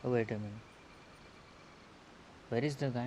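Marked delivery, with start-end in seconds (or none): none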